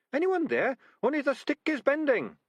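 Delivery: chirpy little rat, unbothered; Sardines's voice. Acted. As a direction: rat in trap calmly reporting.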